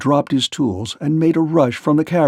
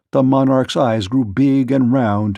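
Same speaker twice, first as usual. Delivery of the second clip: ordinary delivery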